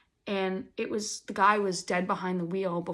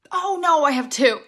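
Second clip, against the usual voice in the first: excited; 7 semitones higher, 10 dB louder than usual. Real, not acted. Catches herself, excited aside.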